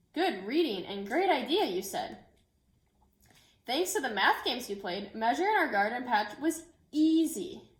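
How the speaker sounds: gentle, expressive picture-book read-aloud; reading to children. Acted. Teacher reading a book to her class, storytelling voice. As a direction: tell the story so the children follow every page.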